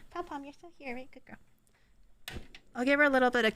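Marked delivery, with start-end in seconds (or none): 0.1-2.4 coaxing pet. Real, not acted. Streamer calling her pet Pom Pom over softly. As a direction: coax the little pet over gently.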